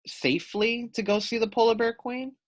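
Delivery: ordinary delivery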